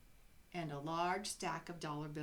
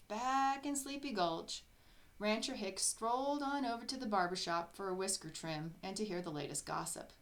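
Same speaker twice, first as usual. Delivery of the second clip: plain reading voice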